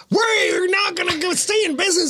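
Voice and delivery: high, shouted used-car pitch; mock ad hype. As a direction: hype it like a crazy commercial.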